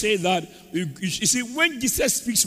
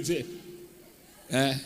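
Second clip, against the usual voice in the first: ordinary talk, nothing marked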